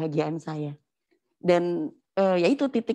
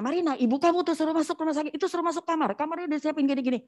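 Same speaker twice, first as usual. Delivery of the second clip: higher-pitched mock urging, quickening at the end; retold urging; 8 semitones higher, faster than usual. Relaying hospital orders to Marina, retold urging. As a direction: imitate someone hurrying Marina along.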